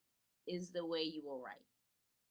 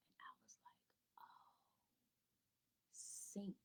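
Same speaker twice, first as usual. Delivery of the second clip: soft, breathy "Oh, sink."; whispered realisation; 17 dB quieter than usual. Retold or imitated. Teacher whispering her realisation to parents. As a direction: hush it like a sudden secret.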